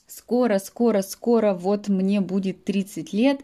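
normal talking voice

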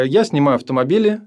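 usual speaking voice

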